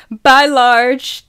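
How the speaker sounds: loud, high, drawn-out farewell; big group-style send-off shout; calling out. A narrator's reading. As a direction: holler goodbye across a parking lot. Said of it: "they call out".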